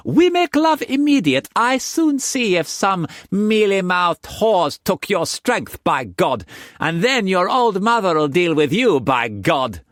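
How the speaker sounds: put-on high, loud playful young woman; Mei Mei's voice. A narrator's reading. Mistress teasing Struan in bed, high playful voice. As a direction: tease him in a high bright voice.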